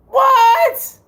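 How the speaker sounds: high, loud "what?" of disbelief; incredulous. Acted. Host scoffs at doubters of Jerry Rice, high and incredulous. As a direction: squeal it in exaggerated disbelief.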